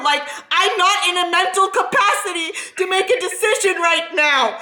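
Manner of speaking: high, worked-up inner outburst; exasperated rant. Retold or imitated. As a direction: vent the indignant reply you held back. Rants her exasperated unspoken reply.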